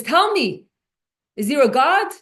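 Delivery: father confronts the maid, loud; demanding father. Retold or imitated. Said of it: "the father was furious".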